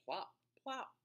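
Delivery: high playful plops; high plop sounds. Shown as a demonstration. Imitating splash sounds.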